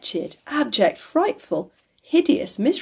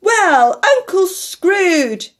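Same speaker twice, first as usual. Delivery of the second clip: loud, high, drawn-out cheer; crying out a toast; 10 semitones higher, 7 dB louder, much slower than usual. A narrator's reading. The company echoes Fred's toast to his uncle, crying out. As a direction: answer the toast with a big cry. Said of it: "they cried".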